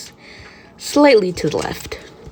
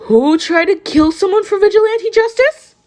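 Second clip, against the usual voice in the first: much higher, louder shocked question; 4 dB louder than usual. A narrator's reading. Someone overhearing Midoriya, shocked high disbelief. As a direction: blurt it out in disbelief.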